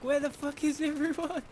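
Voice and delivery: high, loud panicked cry; panicked shout. Retold or imitated. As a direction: yell out confused and panicked. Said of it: "we're like".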